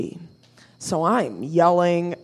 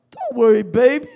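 smooth B; 7 semitones higher than usual. Retold or imitated. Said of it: "he was like".

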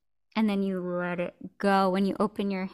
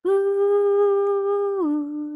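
high ooh; 12 semitones higher, 6 dB louder than usual. Pretend. Vocal play mirroring.

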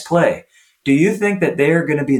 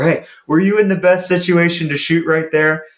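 upbeat question pitched higher; positive inflection; 5 semitones higher than usual. Retold or imitated. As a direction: ask it with a smile in the voice. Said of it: "You have, like, a positive inflection".

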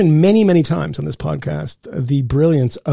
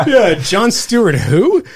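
slow, laughing, mock-dismissive question; amused slow who, with a laugh; 6 semitones higher, 3 dB louder, much slower than usual. Real, not acted. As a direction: laugh it off as if Mill were nobody.

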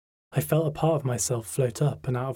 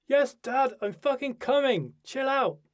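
very high, irritated call back; exasperated teenager; 13 semitones higher than usual. A narrator's reading. Daughter answers her nagging dad, exasperated. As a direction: yell back at Dad from another room.

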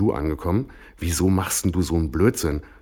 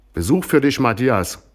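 called out loudly and higher; 7 semitones higher, 5 dB louder than usual. Acted. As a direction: announce the visitor up the hall. Someone announces visitor loudly.

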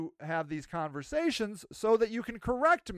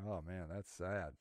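low, quiet pity; 12 semitones lower, 15 dB quieter than usual. Retold or imitated. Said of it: "He's like".

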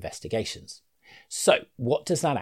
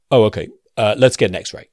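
much louder put-on doctor voice; breezy GP ordering an x-ray straight away; deep-voiced GP; 11 dB louder than usual. GP quickly sending knee patient for x-ray, hammed-up deep doctor voice. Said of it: "Mister Deep Voice G P".

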